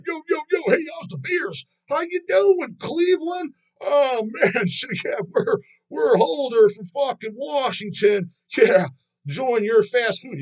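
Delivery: high, goofy bro-frontman greeting a crowd; squeaky, over-the-top stage-banter parody; mock party frontman. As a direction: ham up a chirpy dude-bro singer hyping Cleveland. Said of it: "say stupid shit".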